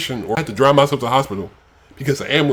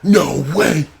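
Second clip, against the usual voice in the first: mocking quote; 7 semitones higher than usual. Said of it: "Like, it's".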